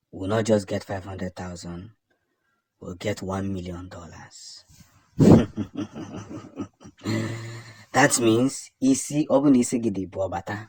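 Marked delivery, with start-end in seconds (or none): 5.2-7.7 a real burst of amused laughter; short scornful laugh. Real, not acted. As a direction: laugh it off, mocking the threat. Laughs at Finland's bounty reply.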